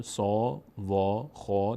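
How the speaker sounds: سا، وا، خا with a full, rounded long aa; full, heavy vowel. Shown as a demonstration. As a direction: open the mouth upward on سا، وا، خا.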